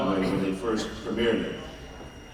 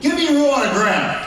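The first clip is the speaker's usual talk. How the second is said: a lightly voiced rude demand, higher; mean fan demand; 11 semitones higher, 5 dB louder than usual. Rude fan demanding an autograph, mildly mock harsh. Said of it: "Anyone I've ever met".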